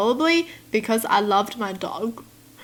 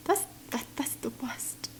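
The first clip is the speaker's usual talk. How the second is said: horrified whisper; 2 semitones higher, 13 dB quieter than usual. Real, not acted. Appalled whisper about the aunt.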